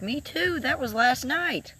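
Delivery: high, slow, sly punchline voice; mock coy reply. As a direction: deliver the joke sweetly innocent, drawn out. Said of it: "And I was like".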